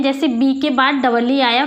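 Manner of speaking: usual speaking voice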